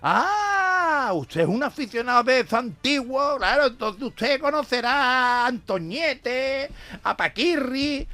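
aficionado insistente. Acted.

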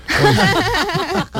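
risa alta y fuerte tras el chiste; carcajada aguda. Real, not acted. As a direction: suelta una carcajada aguda. (se ríe a carcajadas de su propio chiste)

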